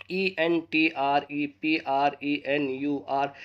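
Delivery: slow spelling. Shown as a demonstration. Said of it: "spelling out letters".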